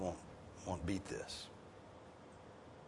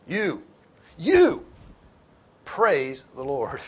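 "you" repeated alone, higher and louder each time; emphatic YOU; 5 semitones higher, 9 dB louder, much slower than usual. Shown as a demonstration. Preacher pointing the command at his listeners, emphatic high "you". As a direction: hit each "you" high and loud, pause, then slow "praise the Lord". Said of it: "and it's emphatic".

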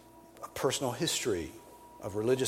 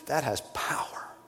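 hushed, breathy whisper; whispered emphasis; 4 semitones higher than usual. Acted. Preacher lets the point land for the church, whispered. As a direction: lean in and whisper it. Repeated line, first whispered.

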